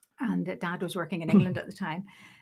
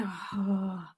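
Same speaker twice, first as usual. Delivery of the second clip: ordinary talk, nothing marked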